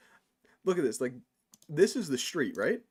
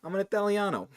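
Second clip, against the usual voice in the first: hammed-up New York Italian boast; mock Italian accent; 5 semitones higher than usual. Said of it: "but I can do the accent".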